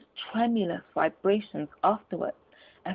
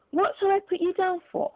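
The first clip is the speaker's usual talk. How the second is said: higher, eager gentleman's question; hopeful, polite ask; 11 semitones higher, 4 dB louder than usual. Acted. Gentleman asks Scrooge for a donation, eager and higher.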